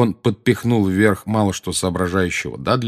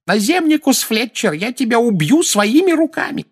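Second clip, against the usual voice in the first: put-on high, loud old woman's rage; high-pitched angry outburst; furious threat; 5 dB louder than usual. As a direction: threaten in a shrieking fury. Woman threatens the absent man.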